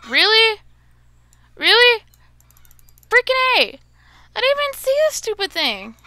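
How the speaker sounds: loud, high furious yell at the game; exasperated yelling. Real, not acted. Gamer yelling at a chicken she missed, exasperated shout. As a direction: shout it out, fed up and outraged.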